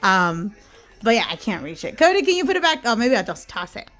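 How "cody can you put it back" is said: loud, high call across the room. Real, not acted. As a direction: call out to someone nearby. Calling to Cody across room.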